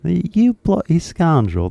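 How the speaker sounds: high, drawn-out British scolding; mock British outrage. British person catching out a fake accent, mock-outraged British accent. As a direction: play an affronted Brit, pitched well up. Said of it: "That's what they would say".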